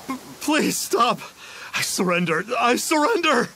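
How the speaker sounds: high, stammering plea; frantic surrender. Acted. Beaten impostor surrendering to his attackers, frantic. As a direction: beg for it to stop, rising to a cry.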